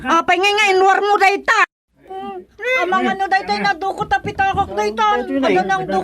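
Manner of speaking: put-on shrill old woman crying out that she is being blamed; agitated old woman. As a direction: cry out like an outraged old woman. Old woman accuses over stolen wallet.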